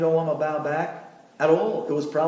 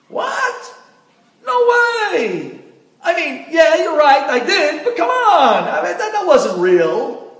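shrill high outburst, then nervous laughing; husband caught out, protesting with a nervous laugh; shocked protest; 12 semitones higher, 9 dB louder, slower than usual. Acted. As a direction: squeal in disbelief, laugh it off.

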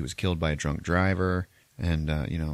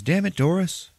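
annoyed sister; 11 semitones higher, 4 dB louder than usual. Retold or imitated. Retells sister scolding, sheets pulled off.

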